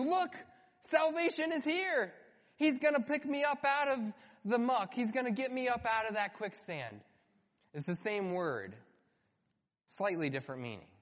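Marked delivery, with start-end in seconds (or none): 0.0-7.0 much higher, joyful and relieved; excited cry. Pretend. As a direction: shout with glad relief.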